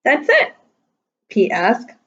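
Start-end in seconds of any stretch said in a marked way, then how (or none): 0.0-0.5 high, rising surprised "That's it?"; a child's surprised little question. Acted. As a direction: ask it in high disbelief.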